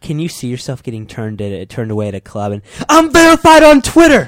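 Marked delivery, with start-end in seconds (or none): 2.9-4.3 loud, high, full-voiced mock brag; hammed-up celebrity boast to a bouncer. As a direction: shout it like a smug wannabe celebrity at the door. Mock bragging at club door.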